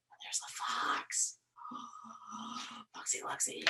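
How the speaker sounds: hushed suspenseful whisper. A narrator's reading. Reader whispering the fox's arrival to the child. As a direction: whisper it like the fox might hear.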